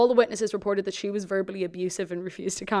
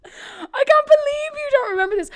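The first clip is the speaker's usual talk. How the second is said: much higher, near tears with laughter; high, crying disbelief; 5 dB louder than usual. Real, not acted. Host wails at her co-host for forgetting, high and crying. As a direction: wail it in mock despair.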